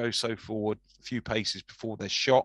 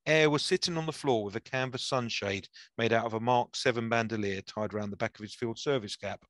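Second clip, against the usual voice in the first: usual speaking voice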